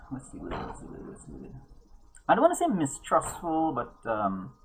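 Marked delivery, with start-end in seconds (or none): 0.1-1.5 muttered fading to a whisper. Real, not acted. GM searching for a word, fading to a whisper. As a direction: search for the word under your breath, trailing off.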